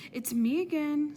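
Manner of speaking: high, loud call of the cook; loud cheery call. A narrator's reading. Miss Mush announces herself at the door, high and loud.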